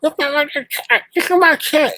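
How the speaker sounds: very high, yappy mimicry; squeaky mocking chihuahua voice; barking chihuahua impression. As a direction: yelp it like a tiny dog. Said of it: "Reminds me of a barking, barking chihuahua".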